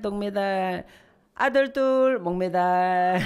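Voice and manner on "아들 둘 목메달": slow, drawn-out punchline with a mock groan; deadpan punchline. Preacher dropping the punchline on the mothers, slow and mock-mournful. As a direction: land the joke slowly for the laugh. Punchline joke about sons.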